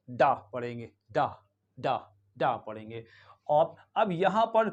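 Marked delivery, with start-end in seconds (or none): 0.1-3.1 डा said as a short schwa "da". Shown as a demonstration. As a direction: say डा short and open, like "duh". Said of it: "तो इसको क्या पढ़ेंगे?". (डा को छोटा श्वा "अ" बोलें)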